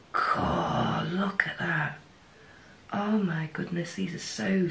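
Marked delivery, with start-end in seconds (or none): 0.1-2.0 awed whisper. Real, not acted. Staring at a swatch.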